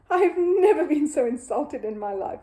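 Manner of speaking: loud, high affronted outburst; indignant. Acted. Mister Curry insulted by the egg, indignant. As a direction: huff in outrage.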